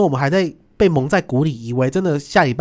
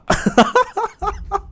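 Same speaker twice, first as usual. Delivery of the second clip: high laugh. Real, not acted. Laughing at embassy faces.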